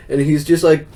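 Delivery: ordinary talk, nothing marked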